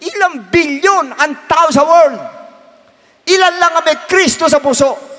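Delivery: high, loud rising preacher's call; impassioned preaching. Acted. Pastor pleading with his congregation, high and loud. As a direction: preach it harder with each line.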